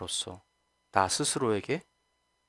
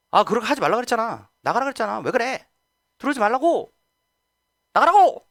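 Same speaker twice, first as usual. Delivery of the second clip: high, loud exasperated scolding rising to a yell; angry scolding; 9 semitones higher, 6 dB louder than usual. Acted. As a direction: scold like a fed-up parent, building to a shout. Scolding quote in sermon. (점점 높아지며 화내듯 꾸짖는 목소리)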